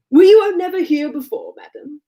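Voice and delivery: high, affected society simper. A narrator's reading. Man resuming mock small talk with his partner, simpering. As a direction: lift it high and fussy, dropping away on madam. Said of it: "with a simpering air".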